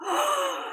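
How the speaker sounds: high, sharp excited gasp. Real, not acted. Unveiling Peter Pan, an excited gasp.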